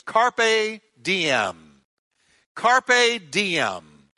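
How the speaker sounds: loud proclaimed Latin motto; preaching at full force. Acted. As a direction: ring out the motto.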